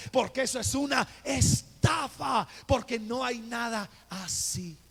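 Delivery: loud triumphant conviction; emphatic declaration. Retold or imitated. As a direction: proclaim it's a scam. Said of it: "yo entendí y dije".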